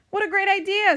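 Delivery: high, loud enthusiasm; delighted exclamation. A narrator's reading. Toad agreeing to play music, high loud delight. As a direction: exclaim it with storybook glee.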